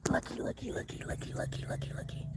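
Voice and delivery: hushed, breathy whisper to keep the bird near; whispered looky. Real, not acted. Birdwatcher whispering to a bird outside, hushed whisper.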